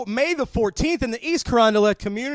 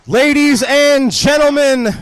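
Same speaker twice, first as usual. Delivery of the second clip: announcer call; 3 semitones higher, 7 dB louder than usual. Acted. Announcer hyping wrestling promo.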